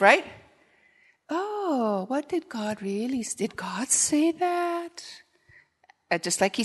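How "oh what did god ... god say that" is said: high, soft, hissing tempter whisper; the serpent whispering doubt to Eve; sly serpent whisper. Pretend. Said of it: "he whispers half-truths".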